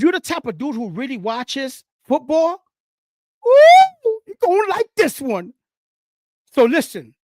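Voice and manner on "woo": high, loud excited whoop; excited woo. Real, not acted. Host hyping up his favorite segment, loud whoop. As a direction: let out a big hype woo.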